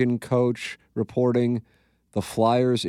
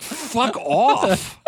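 mock disgusted snap; 12 semitones higher than usual. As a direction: bark it at your own smug post. Scoffing at own post.

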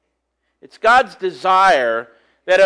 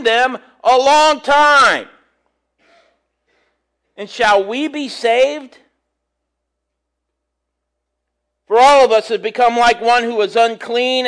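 higher, louder solemn Bible reading; reading scripture to the congregation; 5 semitones higher, 5 dB louder than usual. Acted. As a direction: proclaim the verse from the pulpit. Reads scripture aloud.

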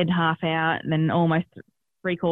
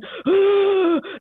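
sharp gasp; 13 semitones higher, 3 dB louder than usual. Retold or imitated. Labour pain while leaning over.

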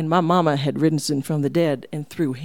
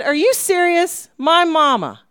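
high, loud incredulous question; can't believe what she hears about her mama; stunned disbelief; 12 semitones higher, 5 dB louder than usual. Retold or imitated. Asking Charles in disbelief about her wild mama.

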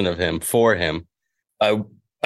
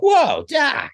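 high startled yelp; Michael J. Fox. Retold or imitated. Actor playing along with the comedian, high Marty yelp. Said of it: "And he turns around".

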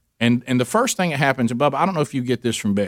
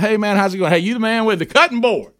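much higher, buddy-buddy schmoozing; mock chummy greeting; 7 semitones higher than usual. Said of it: "I said".

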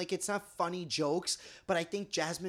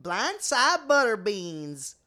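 very slow, loud country twang; country accent; 2 semitones higher, 6 dB louder, much slower than usual. Shown as a demonstration. Showing off the best country voice, big slow drawl. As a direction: draw it way out, country style. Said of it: "Everybody do your best country voice".

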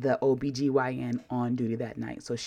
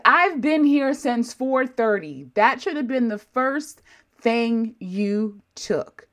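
high, loud, slow retort; exasperated high outburst; 8 semitones higher, 5 dB louder, slower than usual. Retold or imitated. Patient snapping at ER staff, high and loud. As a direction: let the frustration burst out high. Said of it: "I said".

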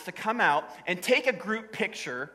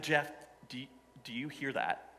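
scared hushed question; 7 semitones lower, 12 dB quieter than usual. Retold or imitated. Scared, hearing rattle behind him.

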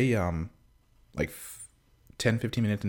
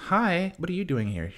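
mock little kid; 11 semitones higher than usual. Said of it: "and he's like".

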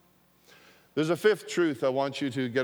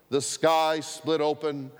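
normal talking voice

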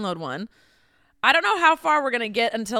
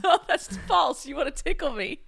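laughing; 7 semitones higher, 5 dB quieter than usual. Real, not acted. Tickled, laughing.

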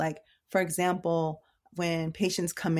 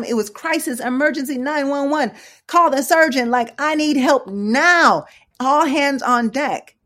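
loud, high, dramatized alarm; mock emergency panic; 8 semitones higher, 9 dB louder than usual. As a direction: call out urgently as if imitating someone in crisis. Voices son's sense of emergency.

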